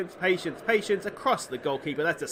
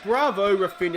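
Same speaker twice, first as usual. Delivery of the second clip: louder, higher bravo; excited praise; 5 semitones higher, 7 dB louder than usual. Real, not acted. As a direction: hail the scorer. Praising the goalscorer.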